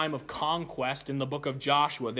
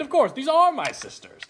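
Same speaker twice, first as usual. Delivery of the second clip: eager reply; 10 semitones higher, 7 dB louder than usual. Retold or imitated. Child eagerly answers his friend.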